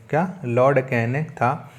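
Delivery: ordinary talk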